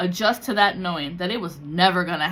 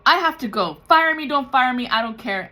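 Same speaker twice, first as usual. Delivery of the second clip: loud, high defiant outburst; defiant yell; 6 semitones higher, 7 dB louder than usual. Retold or imitated. Coworker shouting at her supervisor, defiant and loud. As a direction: shout it back at the boss, quitting on the spot. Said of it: "And she fought him".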